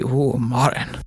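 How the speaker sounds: a breathy, hushed swoon; mock swooning. Teasing her co-host, swooning mock-pleasure over a Toblerone. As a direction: melt with mock delight over chocolate.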